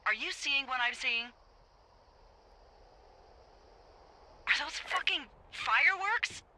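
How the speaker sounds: high, incredulous urgency; alarmed, high-pitched questioning; urgent alarm. Acted. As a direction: demand confirmation, pitched high in disbelief. Urgently confirming sighting of illegal fireworks.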